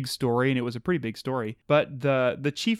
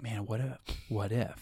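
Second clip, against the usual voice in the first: quiet wondering; 4 semitones lower, 10 dB quieter than usual. Retold or imitated. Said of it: "I'm like".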